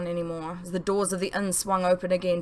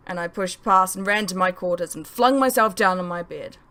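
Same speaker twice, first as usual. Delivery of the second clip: plain reading voice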